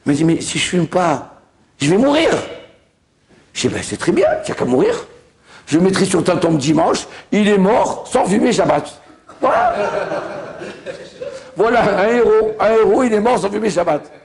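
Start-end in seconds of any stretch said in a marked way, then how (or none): 0.2-2.3 plead, then cry out that you'll die; panicked protest. Retold or imitated. Man protesting he'll die. 3.7-14.1 mock eulogy. Rabbi mocking with a tombstone line.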